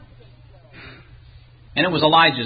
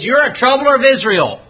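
accusing, pitched-up jab; name-calling; 6 semitones higher, 4 dB louder than usual. Acted. Ahab calling Elijah names, pitched-up accusation. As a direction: throw it as a raised accusation. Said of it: "exchange of calling names".

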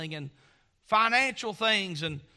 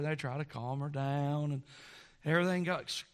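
usual speaking voice

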